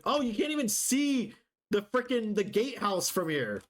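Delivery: high, surprised exclamation; surprised outburst. Real, not acted. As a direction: blurt it out in surprise. Surprised at view.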